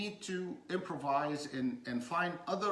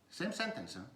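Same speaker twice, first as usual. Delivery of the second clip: normal talking voice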